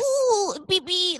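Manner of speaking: put-on very high cooing baby voice. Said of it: "like a baby".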